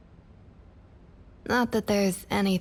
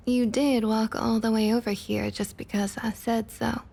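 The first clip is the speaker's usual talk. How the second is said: normal talking voice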